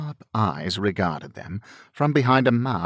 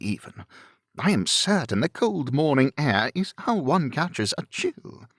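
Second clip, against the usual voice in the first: higher-pitched protest from an affable gentleman; groaning complaint; 6 semitones higher than usual. A narrator's reading. Bingley protests Darcy's early morning plans, groaning complaint. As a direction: groan about it in a lifted, lively voice. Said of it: "Bingley groaned".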